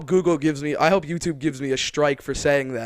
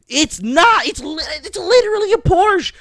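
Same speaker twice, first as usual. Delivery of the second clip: much higher and louder, squeaky insisting; shrill mock insistence; 9 dB louder than usual. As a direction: squeal back in mock outrage. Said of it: "she was like".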